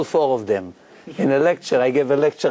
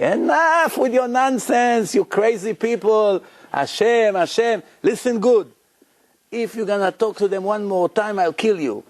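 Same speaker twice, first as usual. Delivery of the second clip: furious threat; 6 semitones higher than usual. Retold or imitated. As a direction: threaten him, losing patience. Mafia man threatens to kill him.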